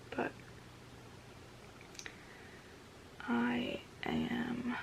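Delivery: soft morning whisper. Real, not acted. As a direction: keep it hushed, others nearby. Early morning, roommates getting ready.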